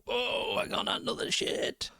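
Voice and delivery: high, airy whoa trailing into slow words; mock amazement. Imagining a viewer on acid, mock amazed whoa. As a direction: play someone tripping, high and slow. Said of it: "I bet it would be like".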